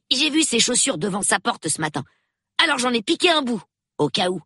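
put-on high, quick child's voice; eager young boy. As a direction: pitch it up like an inquisitive boy. Reader voices young Peter. (voix aiguë de gamin curieux)

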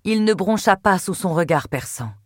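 plain reading voice

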